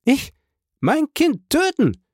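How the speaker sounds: very high, outraged mother; high, drawn-out cry of horror; impassioned cry. A narrator's reading. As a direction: cry out in passionate disbelief, voice high. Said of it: "rief in höchster Leidenschaft diese Mutter".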